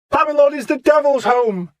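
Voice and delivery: high, loud ranting cry; a mad prophet's shout. Acted. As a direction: hurl it at the hall. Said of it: "he shouted".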